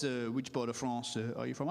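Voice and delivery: put-on low French-accented football manager; Arsene Wenger. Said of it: "Arsene says".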